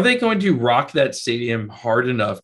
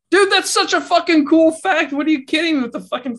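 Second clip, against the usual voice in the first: excited; 4 dB louder than usual. Real, not acted. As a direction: gush about it. Excited rant about cadaver fact.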